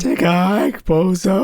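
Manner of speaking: high squeaky echo; broken adolescent voice. Retold or imitated. Co-host echoes host's young John impression, broken voice. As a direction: mimic a cocky squeaky boy.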